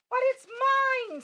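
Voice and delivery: high, loud, slow scream. A narrator's reading. The bird screaming at Horton for her egg. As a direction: shriek to claim it back. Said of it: "screamed the bird".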